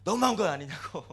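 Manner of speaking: mock tantrum, loud outburst; petulant complaint. Believer throws a tantrum at God, petulant outburst. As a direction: burst out with a childish protest. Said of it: "막 앙탈 부렸습니다".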